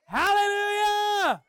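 shouted, high prophetic preaching; full-force preaching. Real, not acted. Pastor to congregation, loud prophetic preaching. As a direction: preach it with rising fire.

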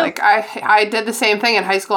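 normal talking voice

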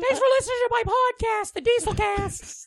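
squeaky stiff host voice. Pretend. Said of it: "like, a higher stilted voice".

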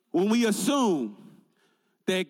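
ordinary talk, nothing marked

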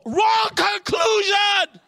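high, loud and slow, dragged out; slow shouted punchline; emphatic punchline. Real, not acted. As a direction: hammer home the wrong conclusion. Punchline exclaimed.

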